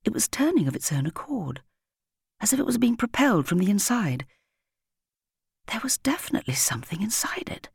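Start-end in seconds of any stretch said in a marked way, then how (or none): 5.7-7.7 soft whisper, pitched a little up; hushed whisper. A narrator's reading. Narrator whispering as Patrick hears squeaking from the sack. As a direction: breathe it like a secret. Realising something alive.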